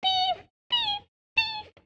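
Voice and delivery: shrill, very high screeching cry; screaming jay. Acted. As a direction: screech it like an outraged bird. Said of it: "with Sammy after him screaming".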